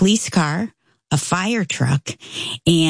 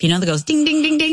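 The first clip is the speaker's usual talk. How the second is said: ringing bells; 11 semitones higher, faster than usual. Retold or imitated. Imitating alarm clock bells.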